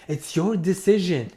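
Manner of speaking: normal talking voice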